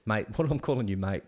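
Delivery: usual speaking voice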